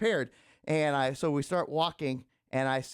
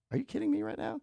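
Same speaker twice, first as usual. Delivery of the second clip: incredulous thought; 13 semitones higher, faster than usual. Retold or imitated. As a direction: voice his disbelief. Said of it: "I think he was thinking".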